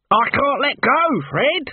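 high, drawn-out wail; alarmed, high-pitched cry; panicked. A narrator's reading. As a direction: call helplessly to a mate. Corporal stuck to spinning weapon.

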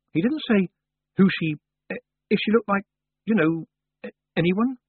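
high, halting, would-be casual question; feigned nonchalance. A narrator's reading. Tiffany probing about the iceberg's face, trying to sound nonchalant. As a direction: fumble towards it as if it hardly matters. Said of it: "trying to sound nonchalant".

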